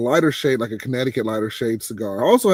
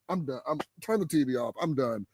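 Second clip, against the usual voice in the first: much softer, giving up on the game; defeated; 9 dB quieter than usual. Retold or imitated. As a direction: mutter it like you've quit watching. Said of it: "I'm just like".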